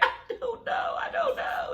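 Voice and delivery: high, wailing mock cry; imitating her own helpless sobbing. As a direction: whimper it out in a high crying voice. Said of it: "I was like".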